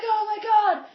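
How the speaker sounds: high, loud cry of alarm; mock panicked yell. Runners see zombies behind them, hammed-up panicked yell. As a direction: play up the horror as you run. Fleeing zombies in retold dream.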